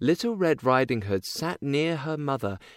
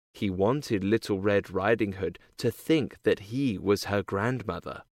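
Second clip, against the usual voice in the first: usual speaking voice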